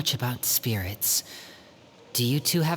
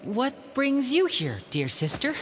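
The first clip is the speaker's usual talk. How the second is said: high, slow, forced politeness; a caught brother playing innocent to his sister; awkward stalling; 9 semitones higher than usual. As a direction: stall for time with fake courtesy.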